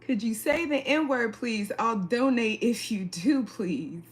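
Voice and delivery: normal talking voice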